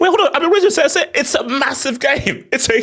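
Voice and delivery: laughing squeal. Pretend. Laughing high mock voice.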